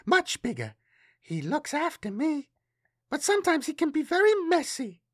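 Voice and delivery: put-on very high child voice; little girl. A narrator's reading. Girl describes her friend to Matthias, high child voice. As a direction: chatter innocently as a small child.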